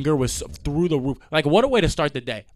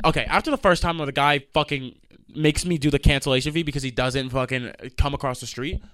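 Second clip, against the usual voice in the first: ordinary talk, nothing marked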